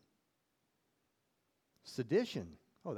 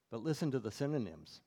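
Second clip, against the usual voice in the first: normal talking voice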